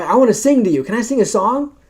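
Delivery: high, eager lady begging to sing; eager tipsy lady. Retold or imitated. Drunk neighbour presses missionaries to hear her song, high and eager. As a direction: push the offer up high and keen.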